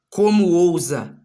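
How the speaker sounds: acted outrage. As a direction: draw it out as an offended villain. Villain challenges hero in retelling.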